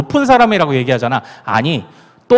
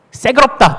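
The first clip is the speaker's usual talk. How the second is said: Gyeongsang dialect, pitched higher on 새그럽다; Gyeongsang dialect word; 6 semitones higher than usual. Shown as a demonstration. Pastor demonstrating Gyeongsang word for sour, in Gyeongsang dialect. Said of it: "시다 할 때 뭐라고 해요?".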